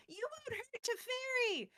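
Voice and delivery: high-pitched mock battle cry; fangirl warrior shout. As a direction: shriek it like a devoted fangirl. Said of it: "An unnamed fangirl warrior shouts".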